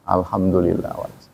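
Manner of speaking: soft, low acted answer; unbothered reply. Villager answering a greeting about health, unbothered and soft. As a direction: answer quietly and low, letting it pass.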